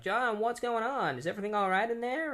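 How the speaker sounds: high, fast worried call through a door; worried Linda. Retold or imitated. Linda calling to John through the door, high worried voice. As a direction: call in, a little anxious.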